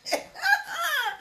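high, soft sudden laugh; a quick high laugh. Real, not acted. To live viewers, a high, soft burst of laughter.

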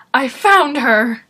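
loud, drawn-out imitation of a guard; mock announcing. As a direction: call it out slowly in mock triumph. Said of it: "You're just saying".

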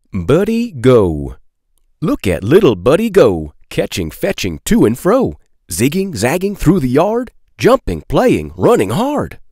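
lively sing-song picture-book reading; reading to children. A narrator's reading. Reading aloud to children, lively sing-song. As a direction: bounce the rhymes for little listeners.

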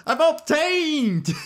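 very high, drawn-out hammed-up boast; mock triumph. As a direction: stretch it high, gloating for laughs. Jokingly gloats over new character.

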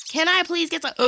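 high pleading with a gasp; excited. Real, not acted. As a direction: beg eagerly, then gasp.

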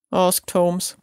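ordinary delivery

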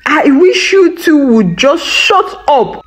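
high, exasperated outburst; frustrated shout. A narrator's reading. Father fed up with wife and son, frustrated shout. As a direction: blurt it out, fed up. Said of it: "shouted in frustration".

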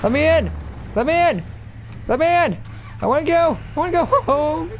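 whiny yell. Acted. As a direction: wail like a kid begging. Pleading yell, mock.

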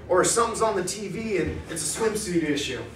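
ordinary delivery

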